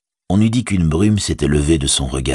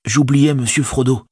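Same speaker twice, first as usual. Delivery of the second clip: high, busy hobbit servant; practical Sam; 9 semitones higher, 3 dB louder than usual. A narrator's reading. Servant planning his master's disguise, high and practical. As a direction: organise things briskly in a raised pitch. Sam's dialogue in narrated story.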